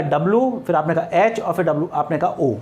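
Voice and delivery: डब्ल्यू, एच, ओ each said as its own letter; letters said separately. Shown as a demonstration. Teacher showing students how WHO is spelled out. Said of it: "हर letter को separate बोला".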